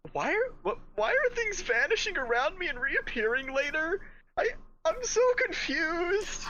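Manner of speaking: high, plaintive mock wail; bewildered. Player's character begs the voice for answers, high bewildered whine.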